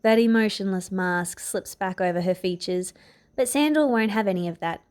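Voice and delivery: ordinary delivery, nothing marked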